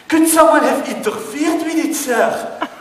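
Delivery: sing-song reassurance from the desk; chirpy desk lady. Retold or imitated. Airline clerk soothing a passenger, high mock voice. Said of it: "desk lady voice".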